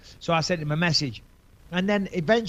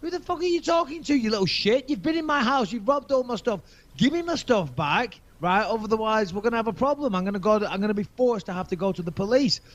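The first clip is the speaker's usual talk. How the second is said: raised, higher heated telling-off; higher, heated mock confrontation on the phone; angry retold rant; 6 semitones higher than usual. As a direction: put the kid in his place, fired up. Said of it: "this guy's getting aggressive with me on the phone".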